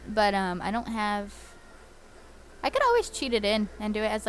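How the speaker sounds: ordinary delivery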